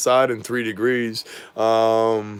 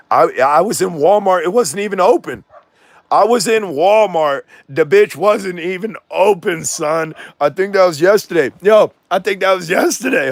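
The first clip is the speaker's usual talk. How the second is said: yelling out; 9 semitones higher, 7 dB louder than usual. Acted. Vlogger over a barking dog, yelled rant. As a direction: yell it out to be heard over the dog.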